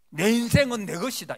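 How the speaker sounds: 크고 높게 외치는 교만한 선언; 거만하게 큰소리치는 목소리; proud boast. Acted. Mocking a proud person's claim. (교만한 사람이 자기 인생을 자랑하듯)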